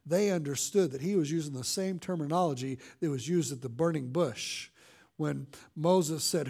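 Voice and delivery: ordinary talk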